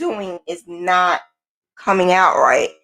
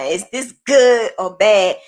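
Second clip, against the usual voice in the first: ordinary delivery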